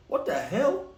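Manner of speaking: plain reading voice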